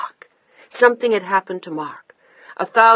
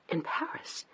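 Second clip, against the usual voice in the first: whispered in disbelief; startled whisper; 4 semitones higher, 13 dB quieter than usual. A narrator's reading. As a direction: breathe the question out, stunned.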